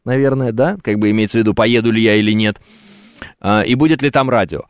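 usual speaking voice

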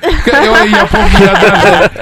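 giggling through the start of a story; laughing. Real, not acted. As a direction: laugh while starting the tale. Смеётся, начиная байку. (со смехом)

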